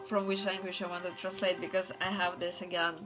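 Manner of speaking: ordinary talk, nothing marked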